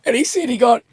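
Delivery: high, helpless laughter through the words; laughing while talking. Real, not acted. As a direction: crack up at your own punchline. Cracking up at his own story.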